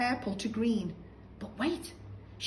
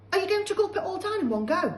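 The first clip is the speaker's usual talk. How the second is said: put-on lightly raised boy's voice, quick; boy George; 10 semitones higher, 6 dB louder, faster than usual. George asking grandma, quick and a bit higher. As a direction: ask quickly like a cheeky boy. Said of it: "George asked".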